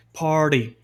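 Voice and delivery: "party" with R sounded and T tapped like a D; T almost a D. Shown as a demonstration. Teacher showing American "party", T as D. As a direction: soften the T of "party" into a quick D. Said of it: "esse T vira quase um D".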